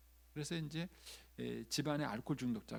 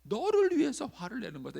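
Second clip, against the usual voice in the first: angry self-justifying outburst, building louder; acted anger; 5 semitones higher, 8 dB louder than usual. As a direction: rise to a high, loud insistence. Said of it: "근데 화를 냈는데". (화내며 점점 높고 크게 따지듯)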